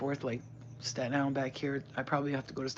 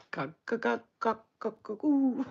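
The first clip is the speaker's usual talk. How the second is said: repeated squeamish attempts, climbing higher; can't say it; 7 semitones higher than usual. Real, not acted. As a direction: keep trying a word you hate. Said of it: "I can't even say it".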